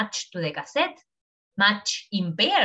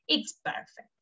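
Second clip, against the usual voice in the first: soft aside; 3 semitones higher, 5 dB quieter than usual. Real, not acted. Teacher approves softly.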